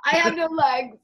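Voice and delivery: high, loud wail as the maimed villain; hammed-up scream of protest; mock screaming. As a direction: imitate someone screaming in outrage, played for laughs. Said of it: "was screaming".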